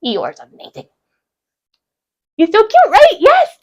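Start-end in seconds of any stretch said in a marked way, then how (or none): 2.4-3.6 high, gushing squeal over cute mug; excited squeal. Real, not acted. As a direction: squeal it with delight.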